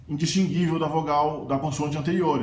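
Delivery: ordinary talk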